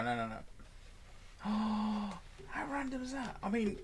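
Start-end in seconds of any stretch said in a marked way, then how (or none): 0.4-1.4 surprised gasp. Real, not acted. Sudden surprise at a rule. 1.4-3.8 high surprised exclaim. Real, not acted. Surprised by a rule.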